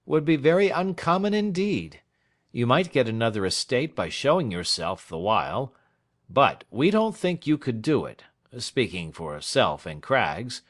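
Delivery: a lawyer laying down advice; dry lawyer Snitchey. Acted. Snitchey's voice in dialogue.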